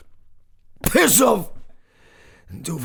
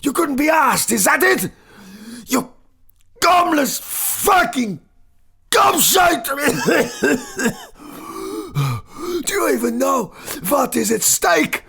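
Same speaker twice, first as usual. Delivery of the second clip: raging shout. Acted. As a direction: tear into him without mercy. Professor rages at composer.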